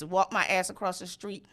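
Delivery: usual speaking voice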